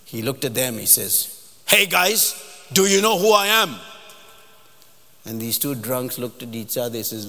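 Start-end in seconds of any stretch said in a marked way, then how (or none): 1.7-3.8 loud, high, commanding challenge; indignant general. Retold or imitated. Shocked general confronting two drunks, indignant. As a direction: pull rank on them loudly.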